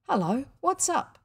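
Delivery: surprised greeting. A narrator's reading. Brother greets limping William, questioning.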